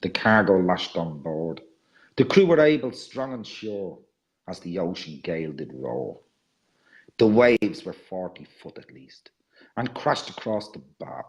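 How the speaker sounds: low, measured verse with strong line openings; dramatic poem reading. Acted. Reading a sea voyage poem to an audience, dramatic poem reading. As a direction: recite a sea ballad, letting each line fall away.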